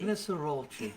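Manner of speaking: high mock British accent; a playful British accent. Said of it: "like you're from Great Britain".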